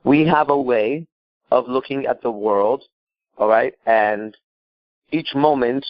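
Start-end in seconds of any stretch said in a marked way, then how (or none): none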